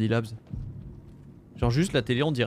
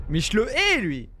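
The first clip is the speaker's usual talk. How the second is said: scared horror game streamer; 6 semitones higher, slower than usual. Real, not acted. Hating the enemy.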